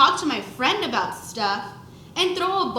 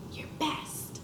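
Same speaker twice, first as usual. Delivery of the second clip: breathy whisper of the words; whispered aside; 2 semitones higher, 12 dB quieter than usual. Acted. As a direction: whisper it like a secret. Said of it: "whispered aside".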